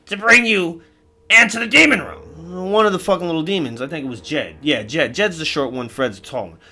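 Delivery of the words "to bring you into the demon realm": loud, high-energy demon character voice; boisterous demon. Acted. Jarred demon greeting his demon friends, loud and boisterous. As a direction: blare it out like a rowdy demon.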